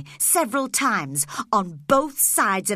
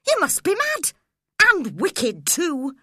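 high, louder scolding cry; outraged cry; 9 semitones higher, 5 dB louder than usual. Acted. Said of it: "I cried".

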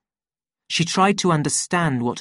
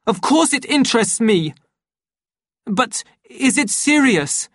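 high, urgent questions growing louder; alarmed phone voice; 10 semitones higher, 5 dB louder than usual. A narrator's reading. Savage hearing on the phone that she is ill, high mounting alarm. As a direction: build from answering to shocked dismay.

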